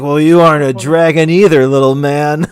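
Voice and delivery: loud tipsy put-down; boisterous, patronizing drunken teasing; condescending. Acted. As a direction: tease him loudly like he's little. Drunk character talks down to player.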